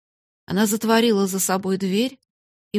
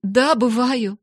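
raised, loud admission; exclaiming; 4 semitones higher, 5 dB louder, slower than usual. A narrator's reading. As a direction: burst out with it. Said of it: "воскликнула она".

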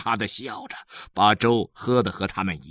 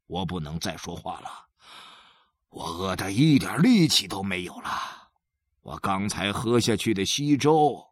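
low, soft, drained voice; father quietly ordering children to lie still, saving his strength; hunger-weakened father; 5 semitones lower, 4 dB quieter than usual. Acted. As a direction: sound spent from hunger, mostly hushed. Father, weak from hunger, gives orders.

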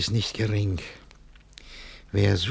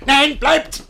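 much higher, loud Nein bleibt; sudden command; 11 dB louder than usual. Acted. Master calling the servant back, loud sharp order. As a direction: stop them sharply.

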